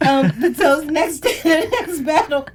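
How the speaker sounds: slow words through laughter; trying to talk through laughing. Real, not acted. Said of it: "while I dab my eyes".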